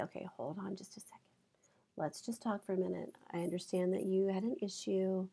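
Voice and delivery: very soft, soothing demonstration. Shown as a demonstration. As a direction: talk an upset student down quietly. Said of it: "I'm gonna use my voice".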